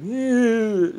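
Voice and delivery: mock high disgusted Ew; a prissy girl's squeal of disgust. Girl brushing off the speaker, high mock disgust. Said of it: "She's like".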